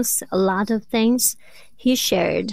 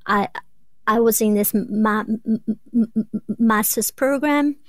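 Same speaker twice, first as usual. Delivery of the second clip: stuck on the m sound, repeating it; stuttering. Real, not acted. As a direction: stammer through the first sound. Guest describes her own stutter.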